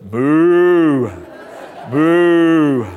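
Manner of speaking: long, loud, drawn-out moo; cow moo. Retold or imitated. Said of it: "the guard heard this".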